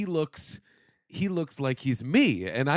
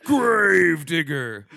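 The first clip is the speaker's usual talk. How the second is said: announcer shout; 4 semitones higher, 8 dB louder, much slower than usual. Pretend. Announcer shouts truck name.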